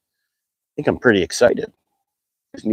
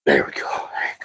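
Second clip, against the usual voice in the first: soft whisper; 12 semitones lower, 7 dB quieter than usual. Real, not acted. Gently unhooking the fish.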